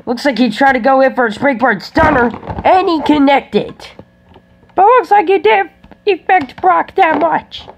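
high, hyped wrestling commentator; play-by-play commentary. Pretend. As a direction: call the fight live. Live play-by-play of toy fight.

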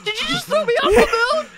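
loud, high outraged laugh-yell; laughing shout. Real, not acted. Realizes friend threw her off.

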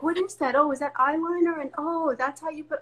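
a hint of a wide-eyed daughter; amazed daughter. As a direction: marvel like a kid discovering makeup. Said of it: "And she's like".

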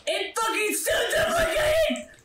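very high laughing scream; disbelieving laugh-yell; laughing shriek. Real, not acted. Boss keeps duplicating.

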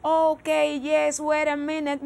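usual speaking voice

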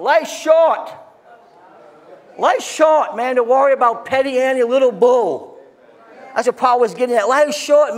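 high, loud fired-up preaching; full-force preaching. Acted. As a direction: preach it hard and loud to the congregation.

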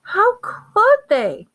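very high, loud, slow cry; choked outcry. A narrator's reading. Hermione after bad news, breathing unevenly, in a choked outcry. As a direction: wail it out, hitting COULD. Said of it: "she choked".

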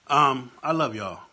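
"I love y'all" said slowly and loudly, pushing it out; emotional outburst. Real, not acted. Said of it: "I'm getting worked up".

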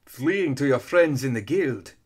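loud, high, slow villain taunt; booming, drawn-out accusation; roaring. A narrator's reading. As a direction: roar it out like an angel of hellfire. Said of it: "her voice reverberated with the roar".

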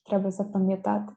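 ordinary talk

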